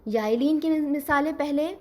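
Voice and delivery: ordinary talk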